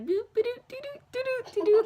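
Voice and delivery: high-pitched nonsense boops and doops; loopy little morphine babble sounds; silly noises. Retold or imitated. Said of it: "I was making noises like".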